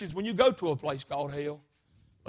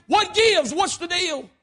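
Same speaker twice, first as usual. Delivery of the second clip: high-pitched, exasperated questioning; baffled demand; 9 semitones higher, 6 dB louder, slower than usual. Acted. Lawyer baffled by the guilty plea, high-pitched demand. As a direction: demand an explanation.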